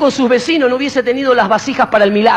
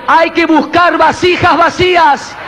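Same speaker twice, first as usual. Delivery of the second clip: preaching shout; 6 semitones higher, slower than usual. Real, not acted. Preacher exhorting the congregation.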